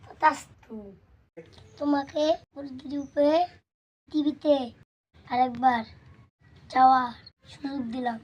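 God voice. Acted. God grants the wish.

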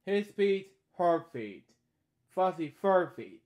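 lively sing-song read-aloud; storybook reading. Acted. Reading a bedtime story aloud, lively. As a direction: read each line brightly to a child.

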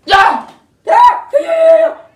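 short, high yelps of pain; cries of pain. Acted. Lars is being cut free, cries of pain. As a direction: yelp in sharp bursts.

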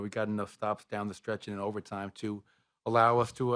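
usual speaking voice

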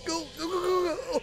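high urgent yelled commands; shouting orders over battle. Real, not acted. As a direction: cheer them on in a panic. Cheering friend through last life.

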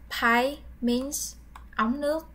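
usual speaking voice

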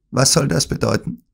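a hint of hard dry question; stunned villain asking dryly. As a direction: ask it curtly. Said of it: "mit trockener, harter Stimme".

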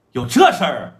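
plain reading voice

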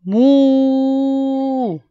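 long, loud, high cow moo; drawn-out cow moo. Acted. Cow sound opens story.

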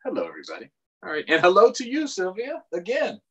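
ordinary talk

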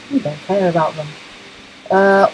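ordinary talk

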